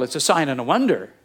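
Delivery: ordinary talk, nothing marked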